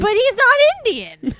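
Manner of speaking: high-pitched, drawn-out mock protest; mock objection. Host mimicking Chris's complaint about casting, high mock protest. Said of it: "he will look at something and be like".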